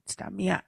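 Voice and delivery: Stamiat said softly as a single word; Stamiat pronunciation. Shown as a demonstration. Repeating the word for listeners, soft pronunciation. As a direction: say Stamiat the way locals do. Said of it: "that's how we say it".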